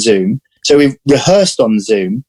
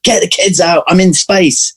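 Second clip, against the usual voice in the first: higher-pitched retold plea to the household; playfully exasperated, raised pitch; mock urgent plea; 6 semitones higher than usual. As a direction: act out a harried parent, without shouting. Retold plea to clear the room.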